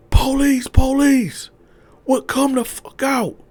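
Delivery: very high, loud commands; police yelling. Retold or imitated. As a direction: bark it like a raid. Swat team bursting in.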